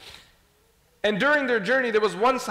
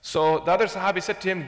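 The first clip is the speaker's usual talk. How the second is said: ordinary talk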